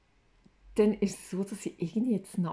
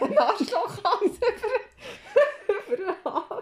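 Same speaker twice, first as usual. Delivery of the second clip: laughing; 13 semitones higher, 4 dB louder than usual. Real, not acted. Imagined thought, laughing.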